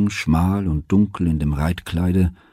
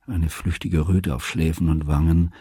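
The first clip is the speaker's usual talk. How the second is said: plain reading voice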